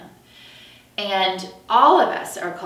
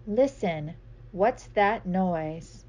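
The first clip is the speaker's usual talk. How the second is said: slow curious question; slower than usual. Acted. Story refrain.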